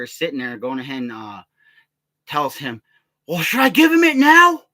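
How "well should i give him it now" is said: very high, loud caricature yell; mocking toothless man. Toothless man asks his accomplice, retold as loud mocking yell. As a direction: push it up high and loud in ridicule. Mimics the toothless man's question.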